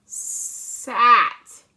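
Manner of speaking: slow stretched blend; sat spoken as a drawn-out blend; sounded out. Shown as a demonstration. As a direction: draw the word sat out sound by sound. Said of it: "Now we have".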